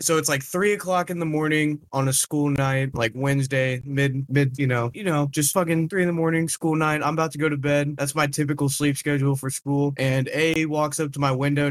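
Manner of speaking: normal talking voice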